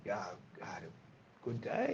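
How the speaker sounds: low, soft, slow contented sigh of a voice; lightly voiced wife settling in happily; mock contented mum. Dad imitating his wife being pampered, low and soft. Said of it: "you just see Tilla like".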